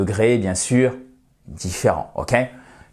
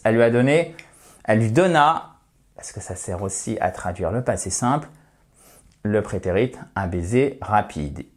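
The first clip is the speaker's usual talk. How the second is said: normal talking voice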